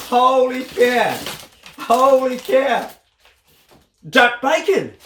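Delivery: awed exclamation. Real, not acted. Vlogger seeing duck sausages in the gift box, slow awe. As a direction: let the wonder stretch each word.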